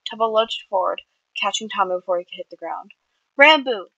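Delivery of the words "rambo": high, loud call for help; scream. A narrator's reading. Tubbo yelling for Rambo to help Tommy, loud and high. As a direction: shout his name across the flat. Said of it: "Tubbo screamed".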